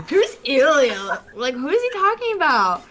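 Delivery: high puzzled mock question; bewildered, who is that; confused question. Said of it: "I just went".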